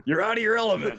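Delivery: hammed-up rebuke; mock scolding. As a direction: shout it like a movie quarrel. Quoting an angry movie quarrel.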